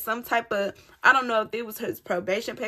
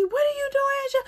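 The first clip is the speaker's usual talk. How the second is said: imitating mom's alarm; faster than usual. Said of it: "and she".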